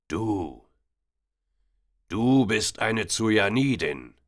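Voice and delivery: put-on a loud, slow accusing blurt from the old man; hervorgestoßen. A narrator's reading. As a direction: point at her and spit it out. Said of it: "stieß der Mann hervor". (laut und langsam hervorgestoßen)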